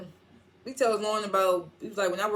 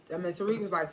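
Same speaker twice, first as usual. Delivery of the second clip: usual speaking voice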